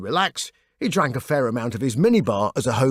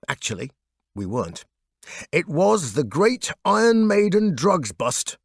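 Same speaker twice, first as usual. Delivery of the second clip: plain reading voice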